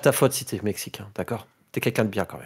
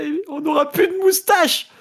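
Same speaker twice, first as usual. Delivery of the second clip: mock despair shout; 6 dB louder than usual. Building to despairing outburst.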